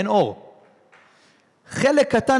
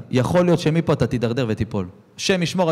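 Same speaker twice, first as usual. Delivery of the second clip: ordinary talk, nothing marked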